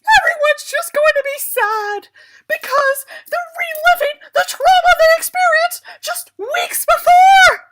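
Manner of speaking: high, loud, stretched-out mock wail; screaming rant. Reader shrieking at the story in mock despair. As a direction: scream it like you're falling apart laughing and crying.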